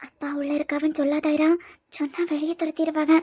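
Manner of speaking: put-on soft, urgent aside to a child; hushed warning. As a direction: warn the child quietly so the others don't hear. Secret warning to child before grandmother.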